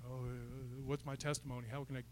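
very soft, low, hesitant mumble; muttering to himself. Retold or imitated. Preacher recalling his search for a testimony, muttering softly. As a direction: think aloud under your breath. Retold fretting to himself in back row.

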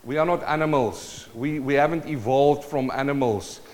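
normal talking voice

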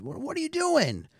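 high, startled squeak of confusion; confused Xavier. Retold or imitated. Podcast host voicing a baffled Xavier: high startled squeak.

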